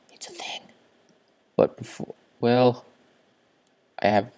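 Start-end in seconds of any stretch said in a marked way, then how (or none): 0.1-0.6 high breathy whisper. Real, not acted. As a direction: whisper in awe. Whispered awe.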